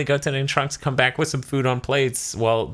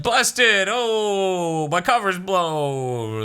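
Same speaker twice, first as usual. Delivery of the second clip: higher, hammed-up caught-out yelp slowing down; goofy Goku; 9 semitones higher, much slower than usual. Goku caught hiding from Beerus, hammed-up yelp. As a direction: play a caught kid, mock dismay. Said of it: "Goku's like".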